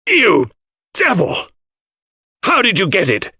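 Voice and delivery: high-pitched furious outburst; howl, almost scream. Acted. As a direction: cry out in outraged shock, voice leaping up. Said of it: "a howl that was almost a scream".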